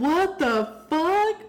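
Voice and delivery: high, slow stunned exclamation; floored by the taste; amazed. Real, not acted. Stunned by sweet lime.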